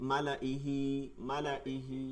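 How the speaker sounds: মালা ইহি with the লা kept short, no madd; no drawing out. Shown as a demonstration. Teacher shows মালা ইহি read without a stretch. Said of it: "টান দেওয়া যাবে না".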